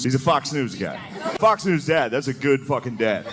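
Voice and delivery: usual speaking voice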